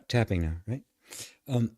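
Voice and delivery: ordinary talk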